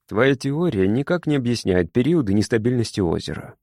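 ordinary delivery

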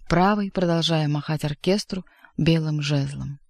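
usual speaking voice

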